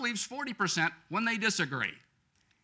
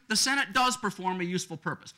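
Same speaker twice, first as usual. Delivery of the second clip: usual speaking voice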